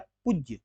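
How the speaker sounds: पूज्य said with a short u, "pujya"; wrong short vowel. Shown as a demonstration. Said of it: "जबकि पूज्य नहीं होता है".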